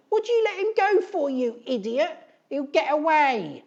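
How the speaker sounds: very high, loud shriek; shouted. Acted. Edith shrieking at Eric, a very high loud shout. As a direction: screech it at her son. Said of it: "she shouted".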